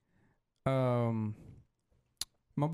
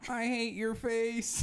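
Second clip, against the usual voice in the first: slow, high robotic voice; 8 semitones higher, slower than usual. Retold or imitated. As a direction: stiff robot impression, drawn out. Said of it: "you know that robotic dude?".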